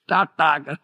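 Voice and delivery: breezy goodbye, laughing through it; carefree goodbye. Retold or imitated. Heedless pedestrian waving off danger, laughing. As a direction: wave it off like a careless walker. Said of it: "You are going like".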